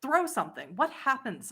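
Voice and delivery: ordinary talk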